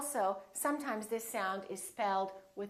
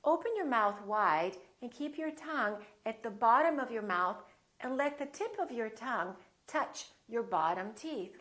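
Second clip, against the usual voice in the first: ordinary talk, nothing marked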